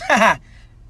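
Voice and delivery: high, loud mock laugh; short burst of put-on high laughter; leprechaun laugh. Leprechaun laughs off the biker's threat, mock laugh. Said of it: "Leprechaun laughs".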